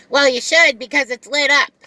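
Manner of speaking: the customer scolding the lost driver. Retold or imitated. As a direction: snap back as the annoyed passenger.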